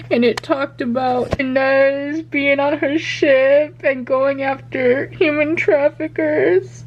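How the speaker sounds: normal talking voice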